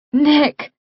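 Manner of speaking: high, pleading cry of his name. Acted. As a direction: cry out to stop him, afraid. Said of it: "I cry".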